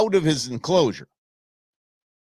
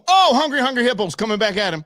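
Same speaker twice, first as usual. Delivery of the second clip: high, louder excited call; 9 semitones higher, 4 dB louder than usual. Real, not acted. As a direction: call the charge like a commentator. Hippo charges again.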